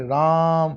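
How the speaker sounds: राम with the रा long and drawn out; stretched vowel. Shown as a demonstration. Teacher demonstrating a prolonged vowel to students, stretching रा in राम. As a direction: hold the रा in राम before closing on म. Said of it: "रा की बच्ची ध्वनि में ज्यादा खिंच जाती है".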